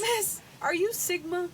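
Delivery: put-on high, slow kid voice, amused; student impression. Teacher mimicking a student's slang question, high slow kid voice. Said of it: "impression of my students".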